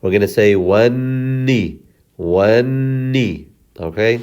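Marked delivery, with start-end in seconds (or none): 0.5-3.5 wanni with a long hummed nn; slow wanni, lingering on the double n; long held shadda. Shown as a demonstration. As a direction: hold the nn of wanni, then release the i. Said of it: "we hold it very long".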